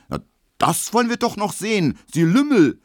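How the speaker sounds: viel höher und lauter, zornrot; wütend rufen. A narrator's reading. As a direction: vor Wut fast überschnappen. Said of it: "ruft Emil Kubinke, rot wie ein Puter". (Emil brüllt Schmelow zornig zurück an)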